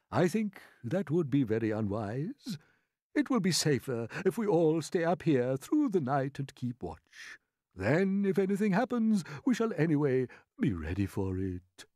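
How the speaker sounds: a hint of a wise old grasshopper. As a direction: calmly reason with the others. Grasshopper explains his plan.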